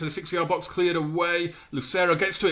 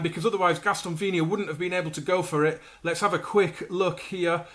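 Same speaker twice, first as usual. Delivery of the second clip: ordinary talk, nothing marked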